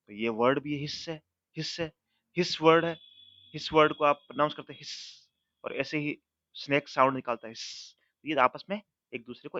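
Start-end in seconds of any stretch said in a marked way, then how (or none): none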